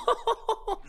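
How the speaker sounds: sudden squealing laugh; high burst of laughter. Real, not acted. Laughing at accent talk.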